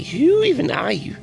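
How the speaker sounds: ordinary talk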